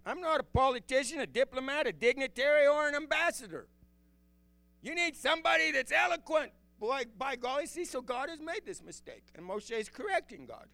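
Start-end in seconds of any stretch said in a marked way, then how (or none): 0.0-6.5 higher, pleading voice as Moshe. Acted. Preacher voicing Moshe to God, higher pleading. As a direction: protest like a humble shepherd who can't speak.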